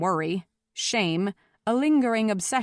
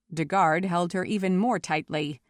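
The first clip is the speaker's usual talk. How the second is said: ordinary delivery, nothing marked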